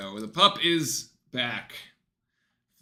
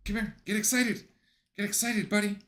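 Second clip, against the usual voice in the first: pet talk; 7 semitones higher, faster than usual. Real, not acted. Hyping up the dog.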